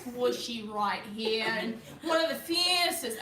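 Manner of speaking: put-on Australian wildlife-presenter accent; Aussie accent. Presenter introduces a squishy animal, Australian accent. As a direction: show off the creature in an Aussie accent. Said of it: "your Aussie accent".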